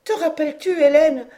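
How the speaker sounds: high, anxious appeal; trembling dread. A narrator's reading. Aunt reminds her niece of an old terror, fearful. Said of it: "ajouta-t-elle les lèvres tremblantes".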